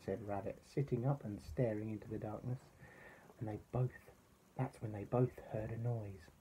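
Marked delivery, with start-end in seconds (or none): none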